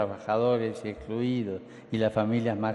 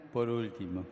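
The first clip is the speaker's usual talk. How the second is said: quiet; 8 dB quieter than usual. Real, not acted. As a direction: say it softly, almost to yourself. Repeats it quietly.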